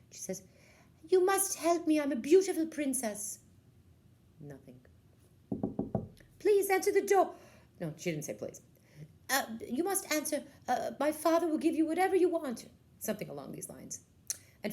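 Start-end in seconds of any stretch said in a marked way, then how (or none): 1.1-3.4 very high, loud demanding voice; haughty princess. Acted. Princess at cottage door. 6.4-7.3 very high, loud demanding voice; haughty princess. Acted. Princess at cottage door. 9.3-12.7 very high, loud demanding voice; haughty princess. Acted. Princess bargaining at door.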